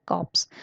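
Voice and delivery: p and s heard in corpse; corpse, final consonants pronounced; 'corpse' with sounded ps. Shown as a demonstration. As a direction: say corpse with its 'ps' ending. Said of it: "ये इसकी pronunciation है".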